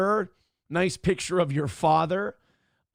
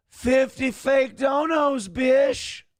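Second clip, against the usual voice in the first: high, slow put-on voice; Pigger Tits voice; 7 semitones higher, much slower than usual. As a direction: drag it out in a silly high voice. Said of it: "Say it in P P P's Pigger Tits voice".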